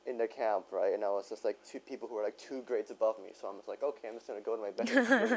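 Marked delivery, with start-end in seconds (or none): 3.8-5.4 laughing through a quote. Retold or imitated. Quoting self, laughing.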